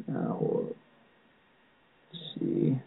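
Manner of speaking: very slow, unvoiced mumble; muttering to self. Real, not acted. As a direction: mumble it to yourself while searching. Muttering while searching for names.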